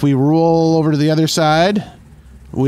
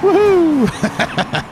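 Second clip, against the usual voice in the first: excited whoop. Real, not acted. Winning the race.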